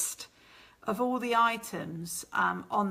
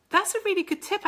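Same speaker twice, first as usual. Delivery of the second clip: excited; 11 semitones higher, 6 dB louder, faster than usual. Real, not acted. Repeats the comment with enthusiasm.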